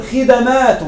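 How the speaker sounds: خدمات said khidamāt, open a on the middle letter; plural with fatha. Shown as a demonstration. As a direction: say خدمات as khi-da-māt with a clear a after the d. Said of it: "مع الفتح نقول". (خدمات بفتح الدال: خِدَمات)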